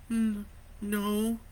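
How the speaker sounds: hesitant mother. A narrator's reading. Mother reassuring her son, hesitant. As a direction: answer uncertainly.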